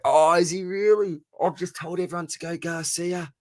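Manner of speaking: high-pitched, caught-out disbelief; real dismay, pitched well up; dismayed surprise. Real, not acted. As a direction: react as if your tip just backfired. Bad news after his tip.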